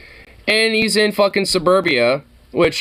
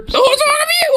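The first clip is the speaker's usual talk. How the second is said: mock shriek. Viewer parrots the officer's question in a shrieking yell. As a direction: shriek it back like a parody of the cop. Echoing the cop's question mockingly.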